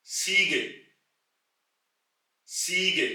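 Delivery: सीगे with a ts start; German word demo. Shown as a demonstration. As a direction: say सीगे, two syllables. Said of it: "इसी तरह सेट से". (सीगे का जर्मन उच्चारण)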